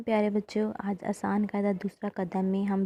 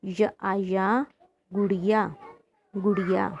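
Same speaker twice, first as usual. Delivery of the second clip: slow spelling-out; slower than usual. Shown as a demonstration. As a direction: spell each syllable very slowly, letter then vowel mark then sound. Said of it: "इसको पढ़ते हैं". (अक्षर-अक्षर जोड़कर बहुत धीरे पढ़ाना)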